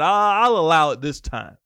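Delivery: playacted magnanimous concession; mock indulgent permission. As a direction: grant it grandly, as a one-off favor. Voices Jesus granting demons permission.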